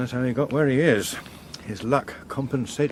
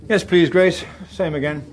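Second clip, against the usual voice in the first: raised voice calling across the room; 6 semitones higher, 7 dB louder than usual. Acted. Ordering another round, calls out to Grace. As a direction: call the order over to Grace.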